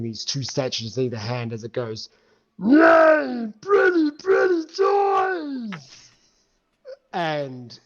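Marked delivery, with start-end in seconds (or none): 2.6-3.5 high, wailing monster shout; booming arrogant alien overlord. Acted. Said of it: "this booming voice roar out". 3.6-7.8 high, wailing monster shout; booming arrogant alien overlord. Acted. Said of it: "this booming voice roar out".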